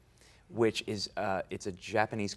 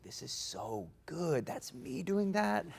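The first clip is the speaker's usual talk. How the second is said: amazed; 8 semitones higher, 4 dB quieter, slower than usual. Retold or imitated. Amazed watching himself on screen.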